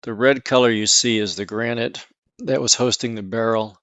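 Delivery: ordinary talk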